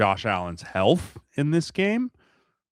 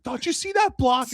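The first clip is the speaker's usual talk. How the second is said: much higher, louder burst; excited; 5 dB louder than usual. Retold or imitated. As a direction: point at the screen thrilled. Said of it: "pointing out stuff like".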